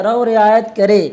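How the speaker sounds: ordinary delivery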